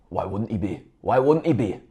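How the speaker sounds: put-on a light Scottish accent, low and quiet. A fan mimicking the manager's Scottish accent. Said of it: "That was my Scottish accent".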